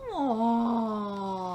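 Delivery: long, low, loving coo; touched "aww". Real, not acted. Reader touched by the god's good night, cooing. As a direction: let out a drawn-out melting aww.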